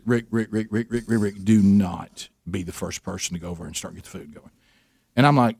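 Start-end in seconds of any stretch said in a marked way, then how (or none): 0.0-4.5 a hint of his wife, lower and softer, pleading; mock-urgent wife holding him back, lower; imitating Sherry. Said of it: "Sherry says".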